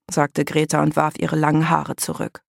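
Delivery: ordinary delivery, nothing marked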